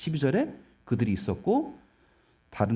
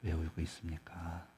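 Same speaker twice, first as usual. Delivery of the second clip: much softer, slow, lower, tender question; hushed gentle angel; 7 semitones lower, 14 dB quieter than usual. Retold or imitated. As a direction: ask the weeping woman gently and quietly. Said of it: "라고 물어봤겠죠". (훨씬 작고 느리게, 다정하게 묻는 천사)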